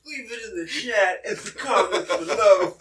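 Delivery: laughing through it; giggling while asking. Real, not acted. Laughing outro plea.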